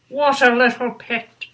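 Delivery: ordinary delivery, nothing marked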